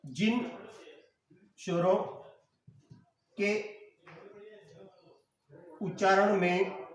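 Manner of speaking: "जिन स्वरों के उच्चारण में" said slowly, long pause after each word; word-by-word dictation. Shown as a demonstration.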